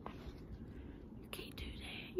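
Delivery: whispered scolding aside; quiet slow whisper. Real, not acted. Aside to pigeon.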